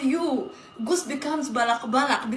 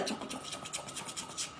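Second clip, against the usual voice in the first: softer, whispered repeated noise; 14 dB quieter, much faster than usual. Acted. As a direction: whisper the sound rhythmically. Whispered imitated sound.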